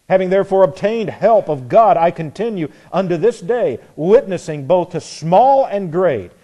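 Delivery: loud, rising sermon reading; preaching scripture. Acted. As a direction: proclaim it from the pulpit. Preaching Paul's defense aloud.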